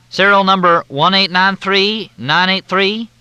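high, loud, syrupy Southern drawl; Southern officer on the phone, in a thick drawl. Acted. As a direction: drawl it out like a courteous Southern major. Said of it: "The drawl was thicker than Mississippi mud".

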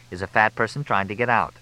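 ordinary delivery, nothing marked

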